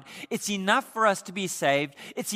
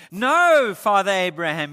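rich man's plea; 9 dB louder than usual. Acted. Rich man in torment pleading loudly with Father Abraham.

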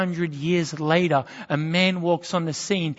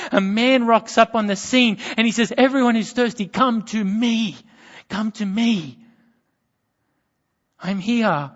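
preaching; 4 semitones higher, 7 dB louder than usual. Acted. Preaching climax, voiced calls.

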